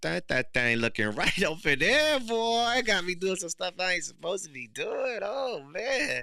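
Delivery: high, loud, excited ogling; excited leering. Pretend. Greedy man spotting a woman, voiced high and excited.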